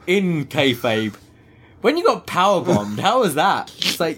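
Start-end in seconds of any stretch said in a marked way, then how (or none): 1.8-3.7 high, sneering Beth voice; mock Beth. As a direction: poke at her loss. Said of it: "this is Beth just going".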